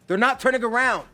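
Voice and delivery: high, loud outburst; yell. A narrator's reading. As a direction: shout it out in alarm. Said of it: "Zuko yells".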